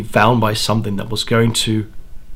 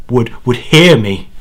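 plain reading voice

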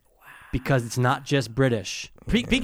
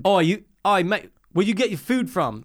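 lightly voiced London street guy asking about food; a hint of a London accent, a bit higher; British street slang; 4 semitones higher than usual. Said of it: "they don't call it drugs, bruv".

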